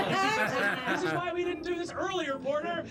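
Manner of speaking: high, exasperated complaint; exasperated scolding. Acted. Villain scolds Porter over the failed plan, exasperated. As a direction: scold a partner, pitched well up. Acted bickering between villains.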